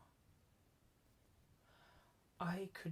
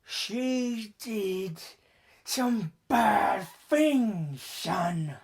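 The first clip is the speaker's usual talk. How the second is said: loud, higher, drawn-out voice of the father; menacing father's voice; 7 semitones higher, 12 dB louder, much slower than usual. A narrator's reading. Killer father justifying the murder to his son, loud and forceful. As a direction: play the father, forceful and slow. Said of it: "his words slipping on his cigarette breath".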